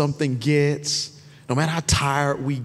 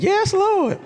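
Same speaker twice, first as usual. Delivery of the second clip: high-pitched church exclamation; mock congregation shout; 4 dB louder than usual. Preacher imitates people cheering a food list, high mock shout. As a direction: cry out like an excited congregant.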